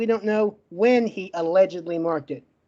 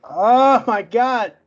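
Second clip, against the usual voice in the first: exasperated relief; 2 semitones higher, 5 dB louder, much slower than usual. Real, not acted. Relief after finally getting the word out.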